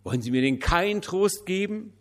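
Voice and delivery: high, loud reproachful plea; pleading cry. A narrator's reading. Widow pleads with the pastor again, high and loud.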